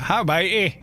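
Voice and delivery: mock Northern Irish accent. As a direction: imitate a harsh Northern Irish greeting. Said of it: "you go to Northern Ireland".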